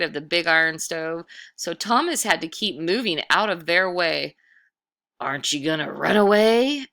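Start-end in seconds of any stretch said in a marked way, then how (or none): 5.2-6.9 louder, teasing ghost voice; ghostly taunt. Acted. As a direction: dare the boy to flee, ghost-like. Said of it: "asked the ghostly voice".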